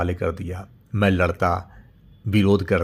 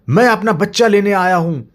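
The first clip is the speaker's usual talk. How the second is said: determined father; 11 semitones higher, 7 dB louder than usual. Acted. Father answers the monster.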